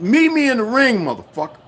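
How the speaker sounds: high, loud challenge, dropping at the end; retold challenge. Challenging the cop to fight, loud retold shout. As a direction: dare him to fight.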